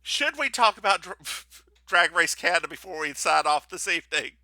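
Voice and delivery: ordinary delivery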